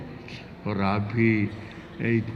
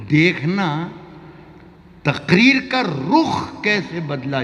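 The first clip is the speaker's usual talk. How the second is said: raised declaiming voice; ghazal recitation; 3 semitones higher, 4 dB louder than usual. Acted. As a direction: recite the verse to the hall.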